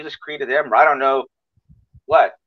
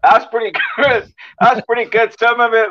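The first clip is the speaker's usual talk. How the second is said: laughing; 8 semitones higher, 4 dB louder than usual. Real, not acted. Host laughs at guest's attempt.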